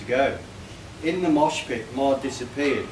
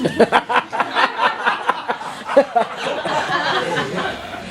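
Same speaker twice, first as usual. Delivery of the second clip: breathy, high laughing; can't hold the laugh in; helpless laughter; 9 semitones higher than usual. Real, not acted. Speaker reading the poem, laughing at the cushion joke.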